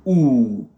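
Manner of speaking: English long vowel "OU". Shown as a demonstration. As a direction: draw out "OU" firmly. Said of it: "c'est un U lent".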